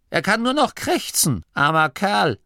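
put-on bossy old Oma. A narrator's reading. Oma Morky hushing the young nome at the sickbed. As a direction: play a stern old granny hushing visitors.

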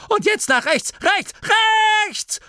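very high-pitched urgent cries; panicked repeated orders; frantic commands. A narrator's reading. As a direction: screech the turn at the driver. Frantic steering orders continue.

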